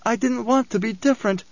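high, plaintive woman's voice; anguished cry, understated. A narrator's reading. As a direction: lament it in a high voice, no shouting. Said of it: "she cried".

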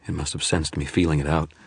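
plain reading voice

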